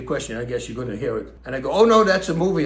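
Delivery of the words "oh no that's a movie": higher and louder, laughing it off to his daughter; a mock exclaim that Rambo is fiction; telling his daughter it's only a movie, up and loud; amused correction. Answers daughter about Rambo.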